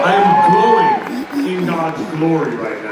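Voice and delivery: loud, high, slow jubilation; hammed-up, stretched-out rapture; exultant proclamation. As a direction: belt it out as if blessed from above. Exults at being recognized with praise.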